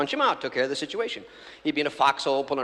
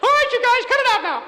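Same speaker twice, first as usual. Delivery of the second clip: very high, loud, laughing through it; exasperated hero; 8 dB louder than usual. Acted. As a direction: yell at the crew to quit. Hero stops the panic.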